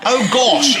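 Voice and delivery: loud, high mock-posh quote; posh exclamation, thrown back teasingly. Host mimicking his guest's refusal to do Common, mock posh. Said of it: "about as far from Common as you can possibly get".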